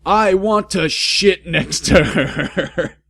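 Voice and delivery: high, loud, slow, cracking up; laughing mock yell. Reader laughing through Draco's line, laughing. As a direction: try to yell but laugh.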